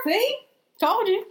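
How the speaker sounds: high loud told-you-so; gloating. Real, not acted. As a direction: rub it in. Gloating after winning.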